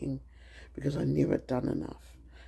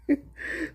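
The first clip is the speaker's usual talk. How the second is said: short high giggle; quick amused giggle; real giggle; 14 semitones higher than usual. Real, not acted. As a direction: let a real laugh escape. Laughing about husband's biscuits.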